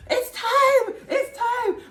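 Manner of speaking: very high, loud mom imitation with laughter; frantic mom. Brother mimics mom in her room, squealing. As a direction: squeal it like a panicked mother. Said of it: "He's like".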